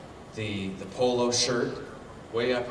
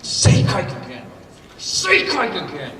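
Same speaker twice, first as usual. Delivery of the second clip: loud, higher menacing challenge; angry demand; 6 semitones higher, 7 dB louder than usual. Retold or imitated. Furious neighbor daring the speaker, loud and higher. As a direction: dare him, loud and seething. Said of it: "He goes, he's getting red now".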